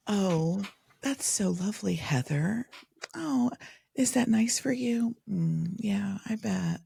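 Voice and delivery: hammed-up snobbish condescension; mock snobbery. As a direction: put on a snooty, patronising politeness. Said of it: "snobbery, like, like having".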